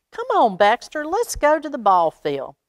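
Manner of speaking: put-on a boy calling his dog to the ball field. A narrator's reading. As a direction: call him over to come and play. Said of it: "he called to Baxter".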